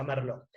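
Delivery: Merlot pronounced "mer-LO"; silent final T. Shown as a demonstration. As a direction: leave the T off "Merlot". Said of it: "Se pronuncia".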